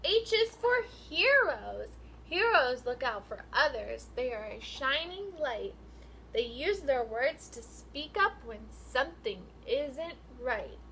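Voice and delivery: sing-song picture book read, rising and falling; reading to children. Acted. Teacher reading a rhyming book to her class, sing-song. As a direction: read the rhyme out to the class with lilt.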